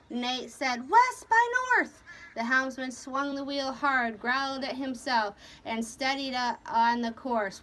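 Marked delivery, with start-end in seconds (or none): 0.9-2.0 loud, high-pitched command; shouted order. A narrator's reading. Captain giving the helmsman a course, shouted. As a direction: call the heading out hard.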